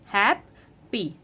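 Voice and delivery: हैप्पी said slowly as two beats, hap-pi; split into syllables. Shown as a demonstration. Teacher showing parents the syllables of हैप्पी, slow and split. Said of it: "clap, divide the word".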